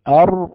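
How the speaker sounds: أر with one short tap of the r. Shown as a demonstration. Teacher isolating a single r tap. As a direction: tap أر once, no rolling. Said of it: "حدث تكرار واحد".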